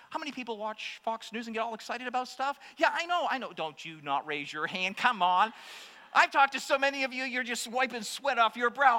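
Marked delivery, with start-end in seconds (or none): none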